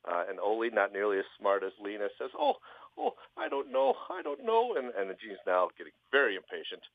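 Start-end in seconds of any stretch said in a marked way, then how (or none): 2.4-4.8 very high dithering falsetto; dim Ole. Acted. Ole stumped by the genie's question, very high flustered voice.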